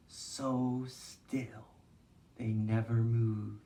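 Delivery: soft, slow storytime voice; hushed, unhurried teacher leading a movement game; gentle guided movement. Acted. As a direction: guide the kids calmly through each shape.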